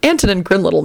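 ordinary delivery

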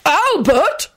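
high, rising indignant query; a mild bellow of outraged disbelief; incredulous bellow. A narrator's reading. Husband reacting to news of Albert, indignant and high. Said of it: "Richard bellowed".

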